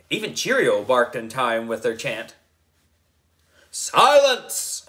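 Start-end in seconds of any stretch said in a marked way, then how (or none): 3.7-4.9 high loud commanding shout; shouted silence. A narrator's reading. Wizard hushing the chanting kids, high loud shout. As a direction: bark it to hush the crowd. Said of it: "I shouted".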